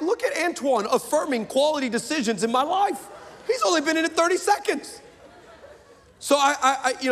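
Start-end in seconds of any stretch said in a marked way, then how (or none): 0.0-5.0 excited mock gush. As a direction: gush like he's charmed.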